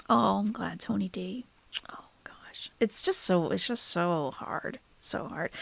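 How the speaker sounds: real grief slipping out in a whisper; tearful whisper. Real, not acted. Streamer fighting back tears while watching a street-dog video, whispering. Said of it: "Wiping the tears away".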